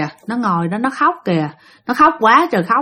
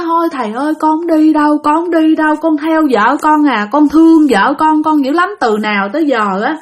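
crying, begging; 6 semitones higher, 3 dB louder than usual. Retold or imitated. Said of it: "khóc nói".

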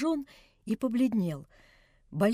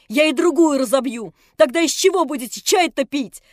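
put-on loud, higher girl's furious taunt; enraged taunt; 3 semitones higher, 8 dB louder than usual. A narrator's reading. Nelli threatening the other cup, furious. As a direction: goad yourself on in fury. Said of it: "Она была как взбешённая".

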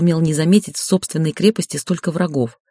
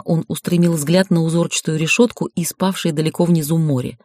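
ordinary delivery, nothing marked

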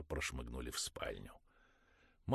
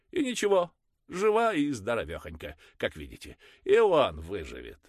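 much higher and louder, boasting; loud shrill mother; 10 semitones higher, 11 dB louder than usual. Acted. Mother dismissing the priest's worry, high and loud. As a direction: brush off the priest's worry loudly.

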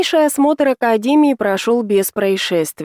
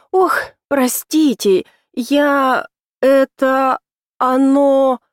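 put-on higher, slow flustered girl babble; babbling; 4 semitones higher, much slower than usual. A narrator's reading. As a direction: stammer it out, flustered. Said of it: "залепетала юная леди".

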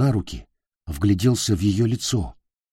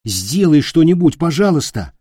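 urgent plea; 7 semitones higher, 6 dB louder than usual. A narrator's reading. Boy begs witch to help.

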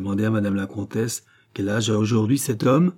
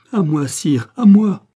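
much higher, louder, desperate; cry for help; 9 semitones higher, 9 dB louder, slower than usual. Acted. The countess screaming to the king for help. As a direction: shout to the king to save her.

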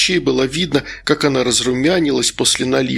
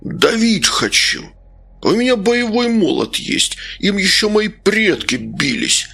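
put-on higher, slurred drunken bluster; drunk, fierce; 7 semitones higher than usual. A narrator's reading. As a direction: boast like a belligerent drunk, dragging words. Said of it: "Он ударил кулаком по столу". (пьяная похвальба, врастяжку)

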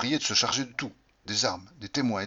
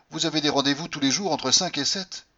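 higher, louder accusing question; jealous accusation; 6 semitones higher, 5 dB louder than usual. Acted. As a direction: needle her with suspicion. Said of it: "j'étais parti pour nous faire souffrir". (accusation jalouse, plus haute et plus forte)

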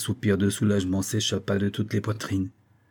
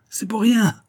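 manic high boast; 12 semitones higher, 5 dB louder than usual. Acted.

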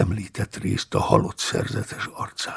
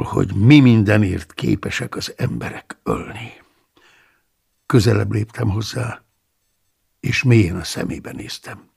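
plain reading voice

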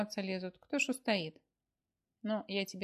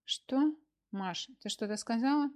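sudden high, louder waking jolt; startled awake; 4 semitones higher, 5 dB louder than usual. Acted. Dozing husband startled awake by his wife, jolted. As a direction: jerk awake mid-snore and ask. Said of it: "встрепенулся".

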